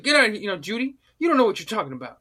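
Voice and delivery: a hint of a fast, brash McBride dismissal; lightly voiced Jesse waving off Judy; Jesse impression. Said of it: "the way he says it, just like McBride".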